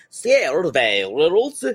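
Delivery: hammed-up Scandinavian accent, words bent out of shape. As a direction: put on a warped Scandinavian lilt. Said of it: "also just kinda Scandinavian".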